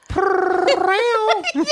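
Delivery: high purring chirp; cat trill. Retold or imitated. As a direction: do a chirpy cat purr-row. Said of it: "I had a cat that go".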